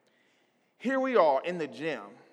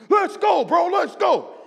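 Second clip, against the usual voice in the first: high, loud gym shout; loud hype; 11 semitones higher, 6 dB louder than usual. Retold or imitated. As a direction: yell it to pump him up. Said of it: "He's loud".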